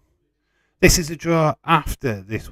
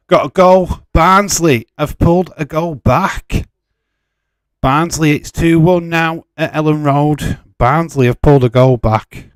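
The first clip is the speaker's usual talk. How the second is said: loud, high goal announcement; breaking goal alert, bursting with excitement; excited goal shout; 6 semitones higher, 8 dB louder than usual. Real, not acted. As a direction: yell out the goal news. Goal alert during live watchalong.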